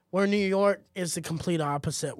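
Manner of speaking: ordinary talk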